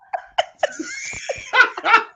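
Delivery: high, breaking peals of laughter; real laughter. Real, not acted. Host reacting to co-host bringing up [name], laughing. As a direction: crack up between remarks.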